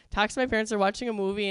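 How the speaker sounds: normal talking voice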